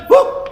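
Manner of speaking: high loud puffing whew; breathless whew. Acted. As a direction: blow out like you're exhausted. Animals run out of breath.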